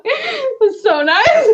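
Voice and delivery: high, slow, laughing squeal of joy; laughing delight. Retold or imitated. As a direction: gush it through laughter, half shouting. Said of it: "I was like".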